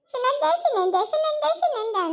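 high, rapid-fire name calling; mimicked calling. Complainer imitating how she keeps being called, high mimicry. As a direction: mimic someone pestering by name. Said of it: "ಅಂತ ಏನ್ ಅಟ್ಟು ಕೇಳಿಸ್ತಾಳ".

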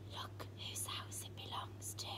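frightened whisper. Acted. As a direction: whisper it in alarm.

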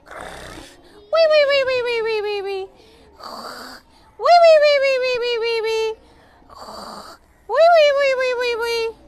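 breathy snore then high whistled wee-wee-wee; cartoon sleeping wheeze, repeated high falling squeals; pretend snoring. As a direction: snore in, pipe out a string of wees. Lion resting, acted snoring noises.

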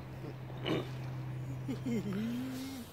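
high breathy laugh. Real, not acted. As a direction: laugh at the photo. Laughter after photo.

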